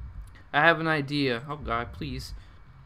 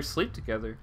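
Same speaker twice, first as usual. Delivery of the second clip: lower, softer echo; quiet repeat; 5 semitones lower, 6 dB quieter than usual. Shown as a demonstration. Player softly echoes Yuri's creepy line, quieter. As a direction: mutter it back under your breath.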